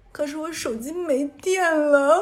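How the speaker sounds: half-laughing mock sob; crying plea. To the subway worker, a slow laughing teary plea. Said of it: "看着那个地铁工作人员又哭了".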